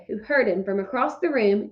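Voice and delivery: ordinary delivery, nothing marked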